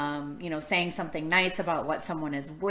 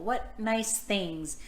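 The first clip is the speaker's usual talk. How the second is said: plain reading voice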